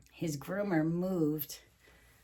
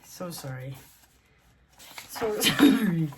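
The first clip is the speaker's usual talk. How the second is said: laughing apology; 8 dB louder than usual. Real, not acted.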